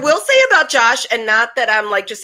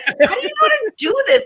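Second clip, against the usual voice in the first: squeaky high amazed question; 13 semitones higher than usual. Retold or imitated. She asks her little brother how he knows computers, high and amazed. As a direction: play the astonished big sister. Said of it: "and I'm like".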